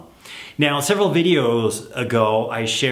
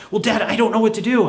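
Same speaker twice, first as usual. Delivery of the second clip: much higher and louder, exasperated; whiny kid; 10 semitones higher, 3 dB louder, faster than usual. Acted. Son on the phone whining at dad, high and loud. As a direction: whine at dad in frustration. Child whining in role-play.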